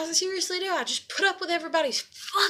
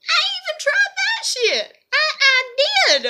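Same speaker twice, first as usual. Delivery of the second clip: squeaky, far higher exasperated cry; high-pitched protest; 13 semitones higher, 5 dB louder than usual. Real, not acted. Vlogger insisting she tried accepting it, high-pitched protest. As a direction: shoot the voice way up in insistence.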